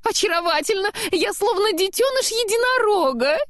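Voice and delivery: very high, gushing snowman; overjoyed. A narrator's reading. Snowman admiring his new nose, overjoyed. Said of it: "снеговик был просто счастлив".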